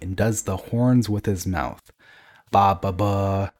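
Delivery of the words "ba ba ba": drawn-out sung-out horn imitation; slow, moderately raised "ba" sounds; roared horn noises. A narrator's reading. Father does the horns with his mouth, roaring. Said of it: "he roars".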